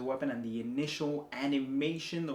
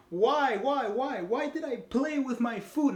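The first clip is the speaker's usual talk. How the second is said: much higher and louder, hammed-up despair; mock anguished wail; 10 semitones higher, 6 dB louder than usual. Wolf regretting toying with Puss, hammed-up high wail. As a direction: wail it like a villain cursing his own mistake. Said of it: "he just gets so mad".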